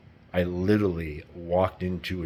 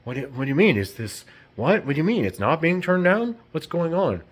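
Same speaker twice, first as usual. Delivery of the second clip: rising, high and louder confusion; acted bewildered questioning; 9 semitones higher, 5 dB louder than usual. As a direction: imitate someone doubting themselves, pitch climbing. Acting victim starting to question.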